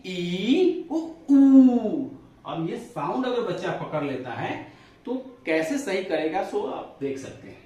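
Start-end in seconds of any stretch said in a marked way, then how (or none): 0.1-2.1 ई and ऊ held long, ऊ much louder and higher; long vowels. Shown as a demonstration. Teacher modelling long vowels to class, loud ऊ. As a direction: belt out a long ऊ. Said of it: "इसको हम बोलेंगे इ".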